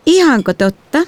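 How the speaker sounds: high, whining mock disbelief; whiny little sister. A narrator's reading. Little sister whining into the dinner talk. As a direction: whine it like a bratty kid sister. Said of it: "Eimin vikinä".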